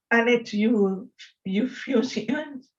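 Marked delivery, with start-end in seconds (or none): none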